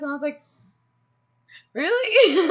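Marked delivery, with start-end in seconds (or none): 1.7-2.5 high, loud delighted disbelief with a laugh; giddy high surprised question; surprised really. Retold or imitated. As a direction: squeal it like you can't believe your luck. Told she can travel with them.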